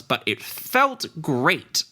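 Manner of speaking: high, slow, sing-song gnome reply; cheeky gnome. Acted. Gnome sorceress brushes off the paladin, high and slow. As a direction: tease him with a lilting no. Sorceress relishes her spell.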